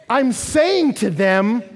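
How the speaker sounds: high, loud, slow preaching; forceful preaching. Real, not acted. Preacher explaining his parenting, forceful. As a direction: hammer it out slowly to the room.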